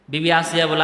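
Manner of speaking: usual speaking voice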